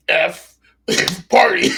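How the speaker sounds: high, drawn-out words breaking into laughter; laughing. Real, not acted. Host spells out the joke party name, laughing. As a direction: crack up while announcing it. Host cracks up at own slogan.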